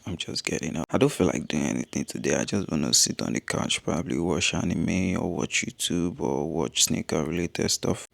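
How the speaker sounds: low, grumpy morning voice; just-woke-up voice. Real, not acted. Vlogger confessing a bad start to the day, low morning voice. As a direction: talk low, like you got up on the wrong side of the bed. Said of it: "as you can hear from my voice".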